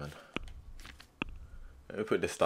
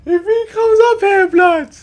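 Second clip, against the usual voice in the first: very high, loud, squealing threat; squealed taunt; 8 dB louder, slower than usual. Real, not acted. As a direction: shriek the taunt at the enemy. Excited game taunt, squealed.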